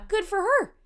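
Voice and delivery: high approving cheer; mock cheer. Real, not acted. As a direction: cheer her on. Cheering Glenn Close's choice.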